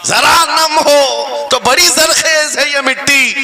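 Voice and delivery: high, slow, ringing verse recital; impassioned poetic declamation; declaimed verse. Real, not acted. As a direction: proclaim the line to the whole crowd, drawn out. Same verse repeated as declamation.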